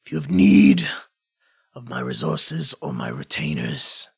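low, grave lord with whispered asides; gruff warrior lord. Acted. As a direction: speak as a proud, grudging warlord. GM voices NPC speech.

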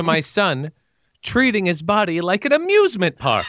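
high-pitched aggrieved mother impression; high mock-indignant mom voice; George's mom. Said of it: "and she's just like".

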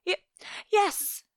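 put-on high, stammering scared child; small frightened voice, catching on the word; frightened croak. A narrator's reading. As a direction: force out a timid, high answer. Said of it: "difficult to croak out the words".

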